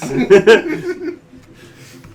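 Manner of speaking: big shrieking laugh; loud high laugh. Real, not acted. Table cracks up at a joke.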